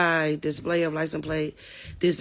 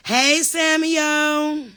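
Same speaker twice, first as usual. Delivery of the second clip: drawn-out call, higher and louder; 9 semitones higher, 5 dB louder, much slower than usual. Real, not acted. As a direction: sing out his name across the room. Calling a viewer by name.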